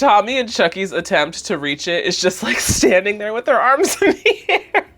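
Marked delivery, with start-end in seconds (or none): 2.4-4.9 high, loud, laughing description; real laughter breaking in. Real, not acted. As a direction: crack up as you describe it. Laughs describing babies reaching.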